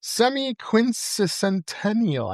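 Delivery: semi-quincentennial spelled out slowly syllable by syllable; careful pronunciation. Shown as a demonstration. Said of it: "trying to pronounce".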